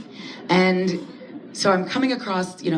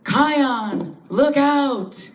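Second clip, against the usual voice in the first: yell; 8 semitones higher, 3 dB louder, much slower than usual. Retold or imitated. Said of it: "And I yell out".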